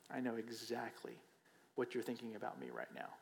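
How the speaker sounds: low, soft and knowing; quiet knowing voice. Acted. Stranger tells you he knows your thoughts, softly. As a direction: lean in and say it softly, almost secretive.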